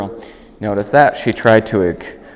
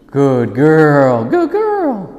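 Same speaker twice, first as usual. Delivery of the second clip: dog praise; 4 semitones higher, much slower than usual. Real, not acted.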